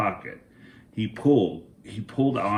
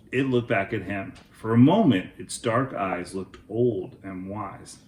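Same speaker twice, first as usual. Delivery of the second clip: ordinary delivery, nothing marked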